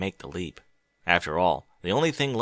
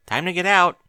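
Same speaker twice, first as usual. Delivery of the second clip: calling out; 9 semitones higher, 7 dB louder than usual. Acted. Twilight calls through the door.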